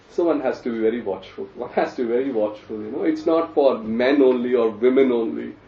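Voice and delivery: normal talking voice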